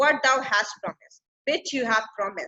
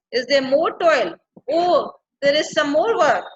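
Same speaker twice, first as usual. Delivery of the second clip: louder, mildly protesting question; unhappy complaint; 3 semitones higher, 5 dB louder than usual. Acted. Ariel learns of more work, mildly unhappy. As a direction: object to more work with a touch of displeasure. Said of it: "like, not very happy now".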